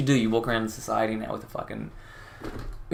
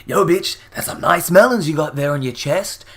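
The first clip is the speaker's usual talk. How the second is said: loud, higher mock catcall; aggressive; 5 semitones higher, 8 dB louder than usual. Commentator imitating prankster accosting someone over melons, loud and aggressive. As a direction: shout it confrontationally, imitating the prankster. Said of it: "he just aggressively approaches them".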